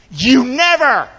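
high, loud, drawn-out accusation; acted indignant protest; accusing outburst. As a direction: hurl it like a resentful son. Quoting the brother's accusation.

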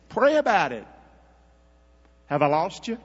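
usual speaking voice

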